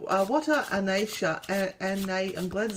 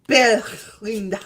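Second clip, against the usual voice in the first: put-on French accent; 6 dB louder, slower than usual. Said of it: "let's give her a French name".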